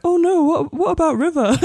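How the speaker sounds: high-pitched, half-laughing mock worry; mock dismay. Fan remembering River during the episode, mock dismay. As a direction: play up a sudden pang of worry.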